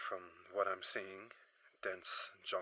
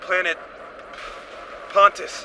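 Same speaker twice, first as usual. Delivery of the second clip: loud distress call over a crackling radio, higher; shouted radio mayday; 10 semitones higher, 16 dB louder than usual. Acted. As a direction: yell the mayday into the comms over the noise. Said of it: "as the radio transmission said".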